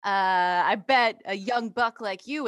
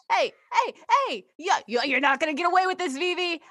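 high, loud and pushy; shouting; 8 semitones higher, 4 dB louder than usual. Acted. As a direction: yell after her, fast and indignant. Aging magician confronts avoiding rival.

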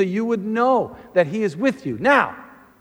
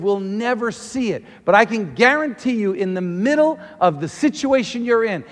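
usual speaking voice

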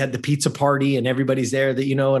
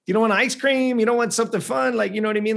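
much higher, disbelieving questions; incredulous mom; 8 semitones higher, faster than usual. Retold or imitated. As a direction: sound amazed he turns down treats. Mom baffled by odd request.

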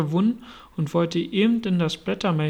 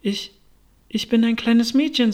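put-on hesitant, higher-pitched little girl; somewhat unsure; 5 semitones higher, 4 dB louder than usual. A narrator's reading. Alice, remembering her many changes, answers somewhat unsure. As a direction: falter after the first word, then offer it. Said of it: "sagt Alice etwas unsicher".

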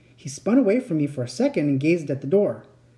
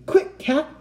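high, urgent call; high-pitched breathless command; breathless urgency; 12 semitones higher than usual. A narrator's reading. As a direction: call out in a hurry. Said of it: "He turned breathlessly to me".

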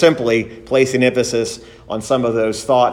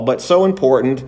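normal talking voice

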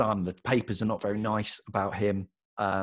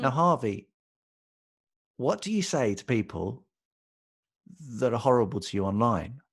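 light Katie Price voice. Retold or imitated. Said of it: "this is Katie Price".

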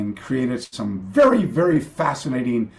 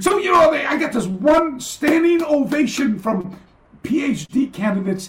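high, loud, slow punchline; loud amused emphasis; 14 semitones higher, 6 dB louder, slower than usual. Real, not acted. Telling the host the punchline, loud and high.

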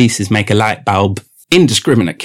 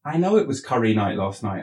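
ordinary talk

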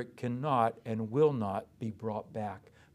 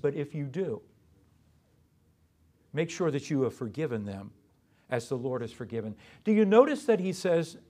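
normal talking voice

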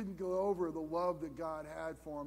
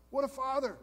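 a loud, high cry of praise; awed exclamation; 6 semitones higher, 4 dB louder than usual. Real, not acted. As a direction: burst out in wonder, pitched up. Preacher exclaims about the father.

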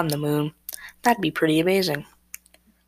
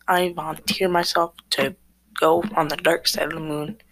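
usual speaking voice

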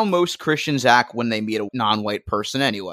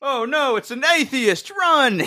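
mock panic; 11 semitones higher than usual.

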